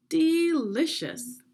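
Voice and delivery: high, loud, drawn-out "Delicious"; delighted. Acted. Queen tasting the ice cream, drawn-out delight. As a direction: savour the word with delight.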